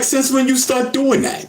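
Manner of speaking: high-pitched mock scolding; retold anger. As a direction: imitate an indignant ex calling him out. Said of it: "she said she was mad".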